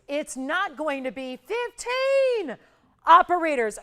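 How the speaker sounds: slow build to a loud call of "operators". Real, not acted. Host to studio operators, a loud call-out.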